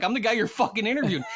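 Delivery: mock outrage at being unknown; indignant retort. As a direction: fire it back, offended. Indignant retort in retold scene.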